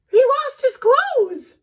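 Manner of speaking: high, loud, slow astonishment; shocked. Acted. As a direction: say it scandalized. Shocked narration, child naked.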